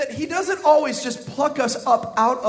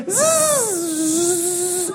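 long, high buzz dropping in pitch; changing drill whine; 8 semitones higher than usual. Shown as a demonstration. Pastor showing how the drill sound goes, long shifting whine. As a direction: imitate a drill digging in. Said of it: "it goes".

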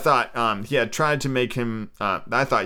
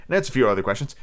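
ordinary delivery, nothing marked

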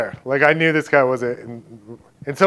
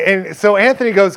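ordinary talk, nothing marked

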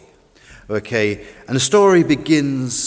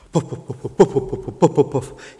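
imitated chug-chug puffing; chugging engine puffs. A narrator's reading. Storyteller imitates the old fishing boat straining ashore, puffing. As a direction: puff out short bursts like an old boat engine. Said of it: "He chugs as his worn out".

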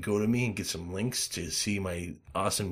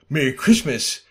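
retold greeting; 5 semitones higher, 8 dB louder than usual. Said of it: "this thing where I said".

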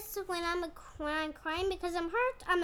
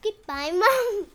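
loud, slow sign-off; loud goodbye; 3 semitones higher, 12 dB louder, slower than usual. Real, not acted. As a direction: call it out slowly. Child signing off show.

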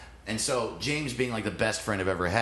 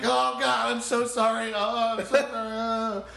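frantic apology; 13 semitones higher than usual. Retold or imitated. Teen apologising to his friend hit in the groin, high frantic pleading. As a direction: gush out sorry over and over. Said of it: "I'm like".

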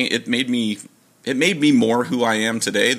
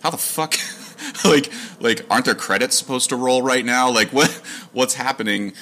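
incredulous disbelief; 6 semitones higher than usual. Retold or imitated. Retold disbelief leaving home by car.

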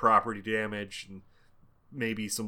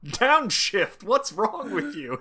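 mock yell; 9 semitones higher, slower than usual. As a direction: bark it like an exasperated passenger. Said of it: "because she yells at him".

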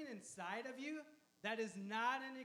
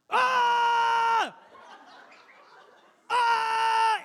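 long, high, very loud mock scream; imitated scream; 13 semitones higher, 19 dB louder than usual. Angry driver screaming in the man's face, imitated. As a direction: scream full-throated, imitating an angry man. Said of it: "He just starts screaming in my face".

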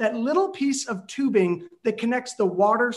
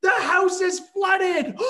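yelling back; 10 semitones higher, slower than usual. Retold or imitated. Son yells flood news to mom, slow high shout. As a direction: drag out the yell, half laughing in panic. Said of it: "And I yelled back".

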